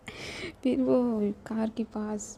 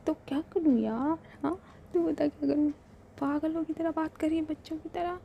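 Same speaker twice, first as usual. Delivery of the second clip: higher, fast, playful exasperation; exasperated teasing; 6 semitones higher than usual. Acted. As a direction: throw it back at her, half laughing. Shivi exasperated with Raavi. (झुंझलाकर, ऊँची तेज़ चिढ़ाती आवाज़ में)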